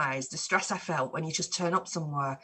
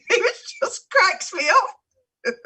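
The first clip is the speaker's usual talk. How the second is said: laughing; 13 semitones higher, 6 dB louder, much slower than usual. Real, not acted. Laughing at the friend's line.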